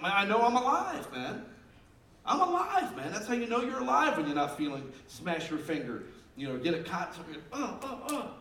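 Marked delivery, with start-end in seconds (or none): 0.0-3.1 high, loud mock-triumphant cry; hammed-up bravado through pain; exclaimed retort. Acts out his saying after injury. 7.5-8.3 short high grunts of pretend pain; hammed-up "uh, uh, uh" of pain; mock pained grunts. Mimes reacting to a hurt finger.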